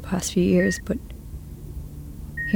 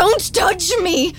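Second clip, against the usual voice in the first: high, slow, frightened plea; frightened cry; 9 semitones higher than usual. Acted. Breed terrified of the blood-covered Val. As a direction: recoil and beg her off.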